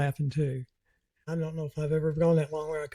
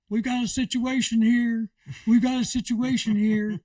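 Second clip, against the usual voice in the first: retold yell; 7 semitones higher than usual. Lady alerting the whole room to a situation, mock yell. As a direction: imitate a woman calling out to the whole room.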